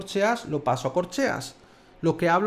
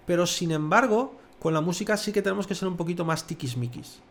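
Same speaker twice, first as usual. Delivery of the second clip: usual speaking voice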